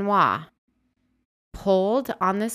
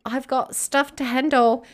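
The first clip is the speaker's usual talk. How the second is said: higher, louder mock British voice; hammed-up British accent; 7 semitones higher, 5 dB louder than usual. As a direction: toss it off like a cheerful Englishman. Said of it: "he becomes British".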